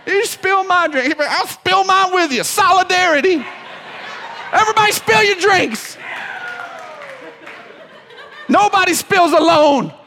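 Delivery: rally everyone like an overjoyed cheerleader; gushing encourager. Retold or imitated. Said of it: "the encourager goes".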